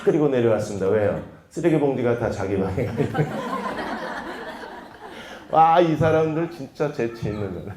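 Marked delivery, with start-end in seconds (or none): 3.2-4.8 breathless high rising laugh; delighted airy laugh. Real, not acted. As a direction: burst out laughing at the joke. Laughs at the prank.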